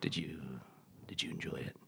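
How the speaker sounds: very soft, low, hesitant tease; intimate whisper. Acted. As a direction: whisper it like a phone-sex line. Teasing guest about phone sex.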